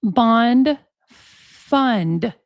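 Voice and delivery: slow imitation with a long gap between words; mockingly very slow. Host mimicking how he said it so we could get it, very slow. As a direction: spell it out slowly, as if for dimwits. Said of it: "He had to say that very slowly".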